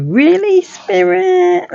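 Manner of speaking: high mock disbelief; incredulous. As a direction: squeak it out in surprise.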